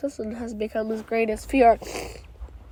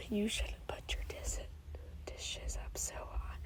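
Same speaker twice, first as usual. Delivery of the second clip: breathy hushed whisper, no voice; 20 dB quieter than usual. Pretend. Toy character whispering the dishes scolding. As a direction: whisper the scolding as if someone might overhear.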